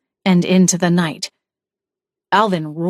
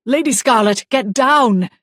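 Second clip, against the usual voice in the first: higher and louder cry to take cover; urgent warning shout; 4 semitones higher, 3 dB louder than usual. Acted. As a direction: yell a warning across the room. Warning shout as knife swings.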